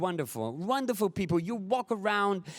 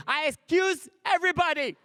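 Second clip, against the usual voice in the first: exasperated proclamation; 8 semitones higher than usual. Retold or imitated. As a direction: shout it to the whole street. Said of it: "stand in the middle of the street and say".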